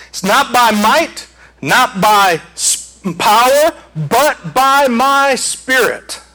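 rising, slowing scripture proclamation; preacher building the verse up slowly; building preaching. Acted. Preacher to congregation, slowly building proclamation.

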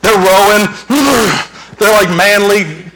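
mock manly rowing voice; manly grunts. Preacher lightly mimics disciples rowing, manly grunts. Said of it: "manly, primal grunts".

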